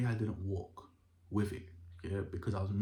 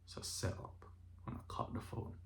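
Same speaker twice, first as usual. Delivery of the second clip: 1 whispered; 10 dB quieter than usual. Real, not acted. Quiet end of a tense call.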